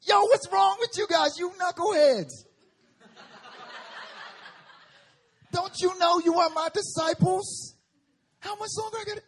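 high, loud frustrated shout; street-style Jesus yelling at his disciples; exasperated yelling Jesus. Retold or imitated. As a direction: yell at knuckleheads. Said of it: "No, Jesus ain't like that".

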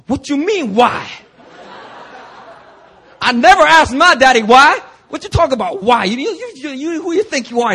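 put-on an offended father scolding a boy; angry Korean dad. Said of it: "He was just angry".

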